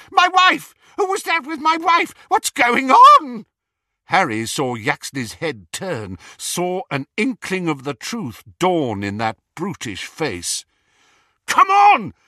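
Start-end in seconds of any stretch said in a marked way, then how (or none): 0.1-3.5 high, loud frantic screaming. A narrator's reading. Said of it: "the real Reg Catamole screamed". 11.5-12.1 high, loud urgent shout. A narrator's reading. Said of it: "Harry shouted at Hermione".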